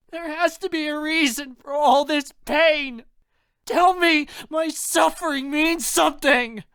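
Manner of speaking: very high, anguished shout; loud sobbing. A narrator's reading. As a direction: scream at God through sobs. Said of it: "in front of me and sob loudly".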